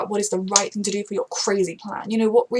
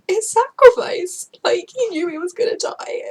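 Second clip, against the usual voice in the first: high, slow, choked-up words; tearful; 8 semitones higher, 6 dB louder than usual. Real, not acted. Fan describing Reed's sacrifice while crying. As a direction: push through tears. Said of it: "I'm just a mess".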